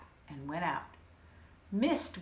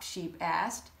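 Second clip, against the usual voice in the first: usual speaking voice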